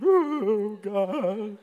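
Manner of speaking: high, loud mock wail; ugly cry weeping. Weeping while walking up front, hammed-up ugly cry. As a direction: exaggerate an ugly, blubbering cry. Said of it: "ugly cry weep".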